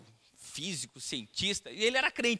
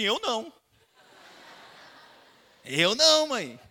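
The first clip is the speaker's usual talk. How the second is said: put-on child protesting; 4 semitones higher, 7 dB louder than usual. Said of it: "Ele falou assim".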